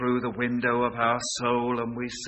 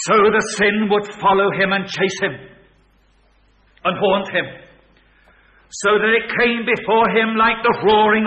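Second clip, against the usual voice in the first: louder and higher, building to a cry; rising preaching climax; 10 semitones higher, 13 dB louder than usual. Acted. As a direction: build the dread line by line.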